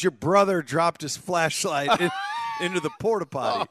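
loud mock exasperation; exasperated dad. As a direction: imitate an angry dad breaking bad news. Retelling dad's angry report.

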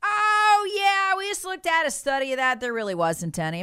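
high, airy offhand confession; breezy mock admission. Mocking the Washington Post shrugging off its Russia coverage, airy. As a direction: toss it off like it's nothing. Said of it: "The Washington Post is like".

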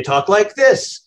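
slow, carefully enunciated broadcaster delivery; mock the polished TV anchor, slowed down; light parody of a newsreader; media announcer voice. Shown as a demonstration. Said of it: "and they enunciate".

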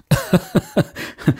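a short airy chuckle, amused; breathy real laugh. Real, not acted. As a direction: let out a quick unvoiced laugh at the story. Laughs at cat story.

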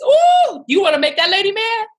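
very high, loud teasing warning; mock warning. Warning people about the angry mother, very high teasing voice. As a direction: tease like a gossip warning you off. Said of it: "That's how you do".

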